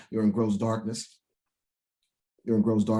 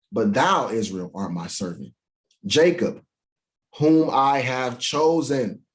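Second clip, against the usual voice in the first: slow solemn scripture read; 4 dB louder, slower than usual. Acted. Preacher reading scripture to the congregation. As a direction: read it out like holy writ.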